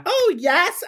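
shrill-high mocking "oh yes"; mock fawning. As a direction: parody fake enthusiasm, way up high.

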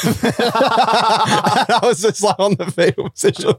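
high, slow, laughing words. Real, not acted. Remembering the captain's serve, high laughing. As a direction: giggle the words out.

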